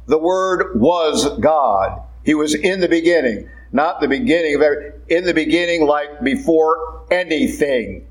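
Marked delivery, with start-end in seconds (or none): 0.1-2.0 "The Word was God" very slow, each word weighted; emphatic. Acted. Preacher repeating the verse to stress its point, very slow and emphatic on "was God". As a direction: dwell on "was God", drawing it out slowly. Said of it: "The Holy Spirit is emphasizing something there".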